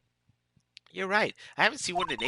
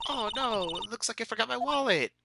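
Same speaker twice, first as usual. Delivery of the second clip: high-pitched oh-no act; put-on helpless excuse; feigned dismay; 7 semitones higher than usual. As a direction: play up the forgotten wallet, pitched high. Acted excuse about a forgotten wallet.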